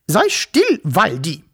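high, loud sharp scolding of the dog. Acted. Fat woman scolds her barking dog Waldi, sharp and loud. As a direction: snap at the dog to hush. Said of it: "schimpfte sie".

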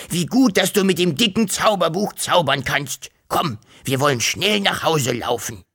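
a dragon who can't wait to run home; happy dragon. A narrator's reading. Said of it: "fauchte er glücklich".

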